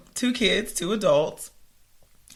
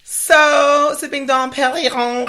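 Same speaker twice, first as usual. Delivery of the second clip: ordinary talk, nothing marked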